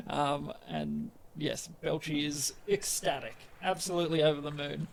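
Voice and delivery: normal talking voice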